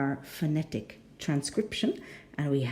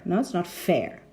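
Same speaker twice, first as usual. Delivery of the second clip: normal talking voice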